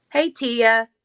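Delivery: ordinary talk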